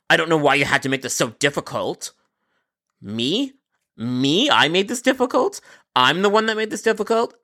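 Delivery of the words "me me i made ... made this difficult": pitched up, disbelieving repeat; incredulous. Real, not acted. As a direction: throw the accusation back in disbelief. Agent incredulous at customer.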